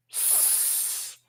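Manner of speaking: "s" as a soft unvoiced hiss; letter sound S. Shown as a demonstration. Teacher shows the second sound of C, a soft hiss. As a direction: hiss "s" quietly. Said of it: "C makes what sounds?".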